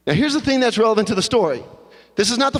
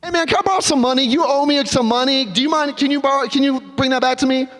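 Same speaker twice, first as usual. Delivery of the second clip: high, fast breezy ask; insensitive chirp; 7 semitones higher than usual. Acted. Friend who is told of job loss asks for money, high fast and breezy. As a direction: rattle it off without a care. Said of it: "Completely insensitive".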